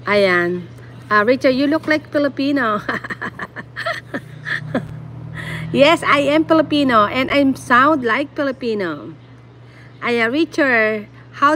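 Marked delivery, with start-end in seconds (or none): 2.9-5.7 breathy amused chuckle, trailing off; laughing. Real, not acted. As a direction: laugh in short, soft bursts. Amused at looking Filipino.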